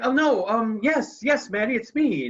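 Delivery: a father fumbling for words; flustered dad. Retold or imitated. Father caught by his daughter, high flustered stammer.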